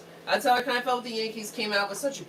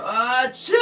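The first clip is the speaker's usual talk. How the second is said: loud fake sneeze; mock sneeze; 3 semitones higher, 7 dB louder than usual. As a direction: ham up a sneeze sound. Acting out a sneeze from chat.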